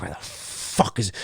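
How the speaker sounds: hushed, slow, threatening demand; a whispered threat through the door; retold tough-guy whisper; menacing whisper. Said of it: "he's really like".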